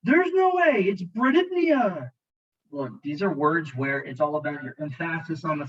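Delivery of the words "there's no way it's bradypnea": high, loud cry of disbelief; mock disbelief. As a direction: act out a sceptical student's protest, drawing out the last word. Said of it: "you're looking at it like".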